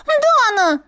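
Дана cried high with a falling wail; indignant yelp. Acted. Otter falls, cries out.

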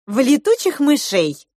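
snide little comeback. A narrator's reading. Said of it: "съязвила Маринка".

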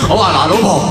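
ordinary talk

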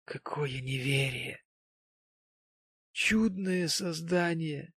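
slow, tender exclamations; tender reproach. A narrator's reading. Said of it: "с нежным укором".

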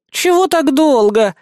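high, slow, whiny complaint; capricious. Acted. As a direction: pout and drag it out. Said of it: "закапризничала Лана".

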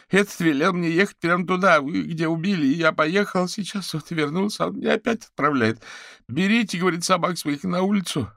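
high, loud aggrieved whine; panicked caller. Acted. Fetisov complains about his orders to Averin, distraught.

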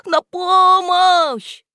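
slow, loud, high cry; yell for help. A narrator's reading. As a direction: stretch the call for help. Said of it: "послышались чьи-то вопли". (отчаянный вопль)

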